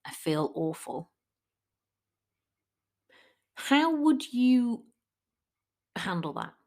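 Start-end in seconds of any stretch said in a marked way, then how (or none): 0.0-1.1 softer, lower mock-glum near-whisper; low, sad confession. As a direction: imitate a friend admitting a bad day under their breath. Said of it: "They're like".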